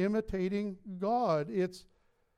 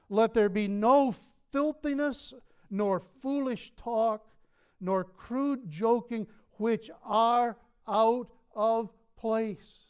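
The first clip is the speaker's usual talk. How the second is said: slow scripture reading; 5 dB louder, slower than usual. Real, not acted. Reading the scripture verse slowly.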